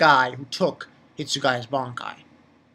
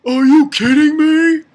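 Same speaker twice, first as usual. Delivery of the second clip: higher, louder stunned outburst; shocked disbelief; 11 semitones higher, 12 dB louder than usual. Acted. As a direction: blurt it out in utter disbelief. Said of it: "He's surprised".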